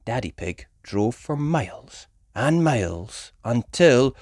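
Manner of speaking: ordinary delivery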